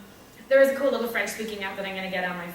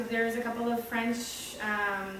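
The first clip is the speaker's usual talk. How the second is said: ordinary delivery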